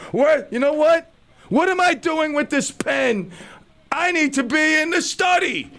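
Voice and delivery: mock-grand shout about the pen; mock outburst. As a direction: bellow the sudden realization. Sudden grand idea, shouting.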